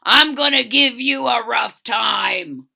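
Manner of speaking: loud, gruff bully shout; tough rhino. Acted. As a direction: bark it like a big bully. Rhinoceros threatens Fluffy.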